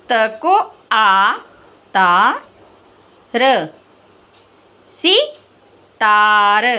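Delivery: slow, drawn-out syllable-by-syllable spelling; teacher sounding out letters, very slow. Shown as a demonstration. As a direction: spell each sound out slowly for the class. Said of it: "कैसे लिखा है?".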